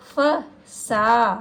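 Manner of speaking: फ सा very slowly, long आ in सा stretched; long vowel held. Shown as a demonstration. Teacher showing pupils where the vowel lengthens. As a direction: hold the आ in सा a little longer. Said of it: "उसको थोड़ा देर तक बोलेंगे".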